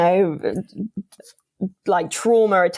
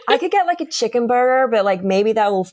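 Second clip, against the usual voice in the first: put-on American accent; 2 semitones higher than usual. Imitating her American date's accent for a laughing friend. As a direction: ham up an American guy musing about his food order. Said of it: "Please do it in an American accent".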